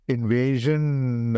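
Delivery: normal talking voice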